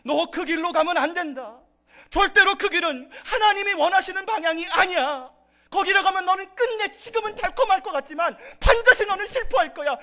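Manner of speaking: high, loud urgent warning; a parent shouting a stern warning at their child. Retold or imitated. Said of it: "대신 부모는 어떻게 합니까?". (자녀에게 높고 크게 외치는 부모의 경고)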